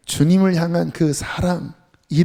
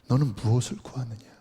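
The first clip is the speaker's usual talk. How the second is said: soft whispered question; 4 semitones lower, 5 dB quieter than usual. Pretend. God asks the congregation, in a whisper. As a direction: whisper it like a searching voice.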